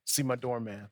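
ordinary talk

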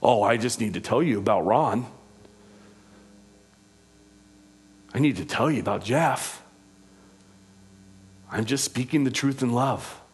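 mock-confiding gossip, pitched low; sarcastic. Gossip confiding about Ron and Jeff, mildly sarcastic. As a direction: lightly act out a self-justifying gossip. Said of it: "Do you hear my sarcasm in that?".

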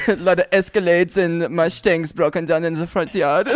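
German teasing with a questioning "ja"; mock German accent. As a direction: needle him in a comic German accent.

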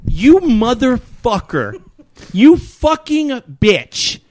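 slow, high-pitched outrage, hitting each curse; drawn-out indignant swearing; retold angry rant. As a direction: act out cursing at the TV screen. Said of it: "I'm screaming at the fucking".

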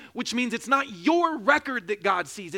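usual speaking voice

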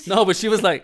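ordinary talk, nothing marked

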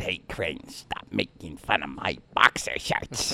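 slow, low-key impression of a threatening man; quiet, drawn-out warning in a put-on voice; Myron Cope. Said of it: "He said".

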